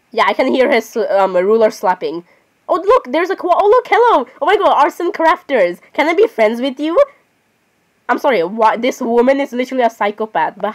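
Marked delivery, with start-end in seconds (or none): 2.7-4.3 high, bright squeal of delight; sudden high-pitched excited hello; excited greeting. Real, not acted. Spots a character, greets excitedly. 4.4-7.0 fangirl gush. Real, not acted. Fangirling over game character.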